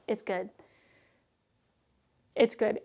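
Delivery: ordinary talk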